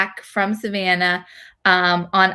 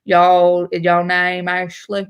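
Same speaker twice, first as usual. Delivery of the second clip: put-on drawled South Carolina accent, hard to understand; slurred rural Southern accent; thick Southern accent; 2 semitones lower, slower than usual. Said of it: "that thick Southern, like, South Carolina accent".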